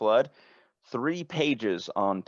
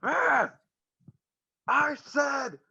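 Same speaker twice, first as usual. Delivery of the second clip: very high mock yell down the phone; high, muffled shouting on the other end; 3 dB louder, much slower than usual. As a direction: imitate someone yelling off the receiver, muffled. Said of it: "like, muffled, like, just, like, yelling".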